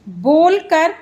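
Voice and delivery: usual speaking voice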